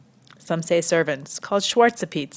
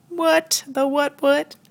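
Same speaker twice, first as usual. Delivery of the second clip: high-pitched feigned disbelief; mock shock; 8 semitones higher, much slower than usual. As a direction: squeak out fake astonishment. Feigned shock at racism finding.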